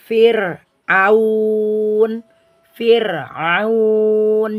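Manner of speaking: Fir'aun with light r; Fir'aun, r thin after i. Shown as a demonstration. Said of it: "ro sukun sebelumnya kasro asli".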